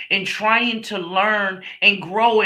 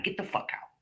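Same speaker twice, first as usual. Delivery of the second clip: whispered "get out" to her husband; mother ordering her husband out in a whisper; retold order; 12 dB quieter, faster than usual. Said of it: "And I told him".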